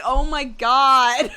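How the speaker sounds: retold shock. Her shocked reaction retold.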